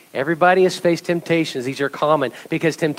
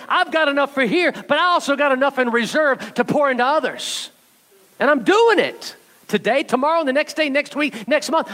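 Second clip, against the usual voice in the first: high, driving sermon cadence calling out to the church; rally the congregation with a raised voice; fired-up preacher pushing the church higher; preaching at full force; 6 semitones higher than usual. Acted.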